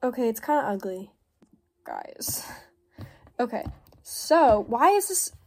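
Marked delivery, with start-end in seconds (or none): 1.8-2.5 whispered. Real, not acted. After calling bracelet ugly.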